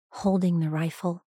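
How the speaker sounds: plain reading voice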